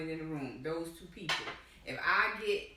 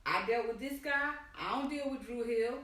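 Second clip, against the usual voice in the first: imitating a smug, higher-pitched explanation; mock self-satisfied voice, pitched up; 5 semitones higher than usual. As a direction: act out someone smugly laying down how it works. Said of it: "He was so smug and saying".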